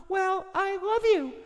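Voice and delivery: slow, very high falsetto confession; hammed-up falsetto sweetheart; lovestruck partner. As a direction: confess it in a squeaky put-on voice. Falsetto partner turns to love.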